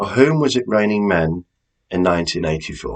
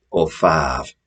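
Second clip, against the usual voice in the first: five said the Hull way as in Hull, a light Hull vowel on five; Hull accent; 2 semitones lower than usual. Shown as a demonstration. Quizmaster teasing Hull players, light Hull accent. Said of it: "if you come from Hull".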